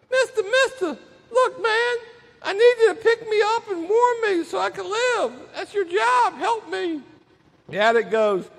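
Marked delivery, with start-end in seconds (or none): 0.1-7.0 high, thin put-on snake voice; pleading snake. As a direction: beg for help in a high, pitiful character voice. Snake begs to be picked up.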